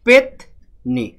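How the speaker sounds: very slow, drawn-out পেতনি; teacher sounding out a word slowly; slow demo word. Shown as a demonstration. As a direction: stretch পেতনি out so each syllable is heard. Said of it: "ধরুন আমি বললাম".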